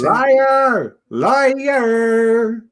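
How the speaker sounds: high, shrill-free quoted yell "Liar!"; mock shout. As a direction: shout it up high like the old wife. Valerie's Princess Bride shout.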